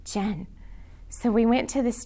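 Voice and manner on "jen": whispered tones. Retold or imitated. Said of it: "in whispered tones".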